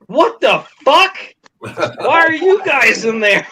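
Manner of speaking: high, slow, incredulous outburst; stunned disbelief. Retold or imitated. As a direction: draw out each word in shock. Stunned guards see them inside.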